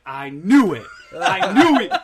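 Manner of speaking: excited mock shout. Bar patron told about aliens, imitated excited shouting. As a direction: shout it like a thrilled true believer.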